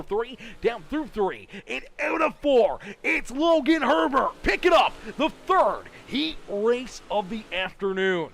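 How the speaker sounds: loud, high shout at the line; live finish call. Real, not acted. Announcer calling the heat winner at the flag, shouted play-by-play. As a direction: punch out the winner's name at full force.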